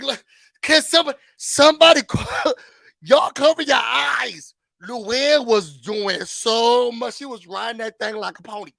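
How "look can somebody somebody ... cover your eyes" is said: excited mock shout, fast then laughing; retold shout. Telling viewers how she reacted while watching, loud and laughing. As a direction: yell it at the room through a laugh. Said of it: "I was like".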